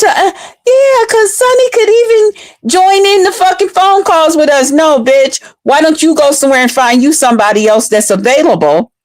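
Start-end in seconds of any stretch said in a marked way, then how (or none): 0.0-4.7 high, put-on mocking voice; mocking the rival. Mocking Mia's bragging about Sonny's calls, high and snide. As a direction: parrot her in a silly high voice.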